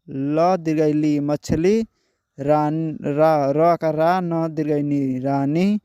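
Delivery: each letter and vowel sign sounded out one at a time, then the whole word; teacher spelling out Hindi letter by letter; spelling out syllables. Shown as a demonstration. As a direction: sound out every syllable before joining it into the word. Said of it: "तो चलिए पहले उच्चारण के साथ पढ़ते हैं".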